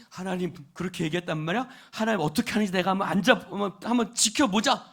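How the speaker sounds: fast, loud mock huffy grumble; 씩씩거리며. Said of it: "씩씩거리고". (씩씩대며 빠르고 크게 투덜대기)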